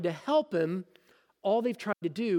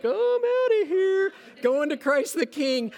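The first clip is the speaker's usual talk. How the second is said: much higher, louder mock churchgoer; bolting churchgoer; 10 semitones higher, 4 dB louder than usual. Preacher mocking a scared listener, high squeal. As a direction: squeal like a spooked churchgoer leaving. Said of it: "Some of you are like".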